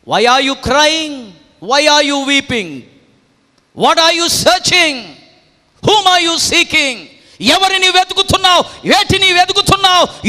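high, shouted questions hurled at the congregation; impassioned pulpit shout, easing at the very end; full-force preaching. Real, not acted. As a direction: cry out each question, long and ringing.

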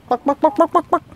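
high-pitched buck-buck chicken clucks; chicken clucking. Retold or imitated. Clucking back at his hens in a high mock cluck. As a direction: cluck like a hen to the girls.

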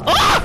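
short, very high loud shriek; sudden real shriek; startled scream. Real, not acted. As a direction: scream as if just hit. Screams when hit in game.

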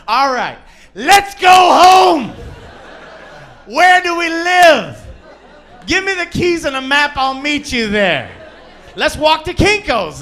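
high, loud, stretched-out shouts; hammed-up happy dog yelling; excited dog. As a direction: burst out like an overeager dog. Acting out the dog's excitement.

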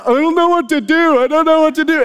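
put-on high whining kid voice, lightly done; whiny kids. Kids answering their father, mock whining. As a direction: mimic helpless children. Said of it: "They're like".

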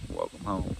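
a sinister low "Welcome home"; menacing low greeting. Retold or imitated. Tony welcoming King home, sinister and low.

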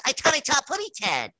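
high, fast little bird voice; high, quick baby-bird cartoon voice; Tweety Bird. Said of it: "like when he did Tweety Bird".

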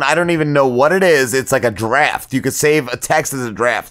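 usual speaking voice

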